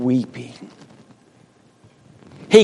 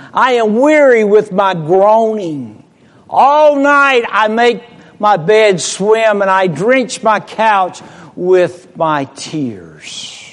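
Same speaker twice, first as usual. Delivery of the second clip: raised, forceful preaching read; preaching scripture; 7 semitones higher, 4 dB louder than usual. Acted. Preacher reading Psalms to congregation, raised and fervent.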